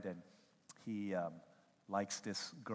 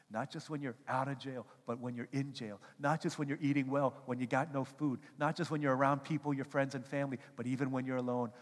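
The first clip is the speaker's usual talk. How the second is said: usual speaking voice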